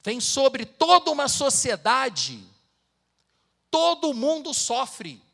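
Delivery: fiery sermon climax; preaching at full force. Acted. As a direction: drive the warning home to the congregation.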